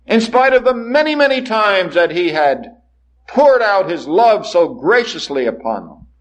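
rising and falling sermon cadence, building in waves; preaching. Acted. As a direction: preach it to the congregation with conviction. Preacher delivers sermon to congregation.

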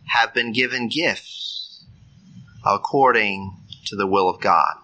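ordinary talk

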